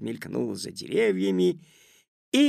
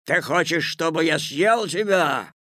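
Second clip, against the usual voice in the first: loud, raised menace; angry Wolf; 6 semitones higher, 6 dB louder than usual. A narrator's reading. Wolf threatening to eat Petya, angry and loud. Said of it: "злобно спросил он".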